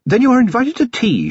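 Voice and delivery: bright, high-pitched cheerful hostess; cheery upper-class invitation. A narrator's reading. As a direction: issue it gaily in a high lady's voice. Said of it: "she said cheerfully".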